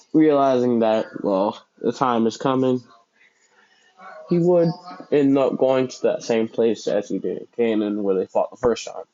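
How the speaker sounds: usual speaking voice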